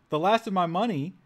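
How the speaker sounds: outraged double take at "last"; "The last of my money?" thrown out high and loud in disbelief; surprised. Real, not acted. Incredulous reaction to game line.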